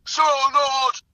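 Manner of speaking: high, loud, crawling wizard cry; Gandalf. Retold or imitated. Host wailing Gandalf's line. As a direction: stretch every word into a wailing command. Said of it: "I can do the bell rock".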